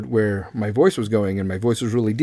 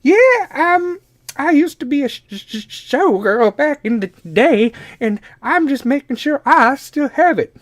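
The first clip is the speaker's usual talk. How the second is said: put-on cranky old grandma; 14 semitones higher, 4 dB louder than usual. Grandma defending herself to grandkid, high indignant old-lady voice. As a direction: bristle like a proud old grandmother.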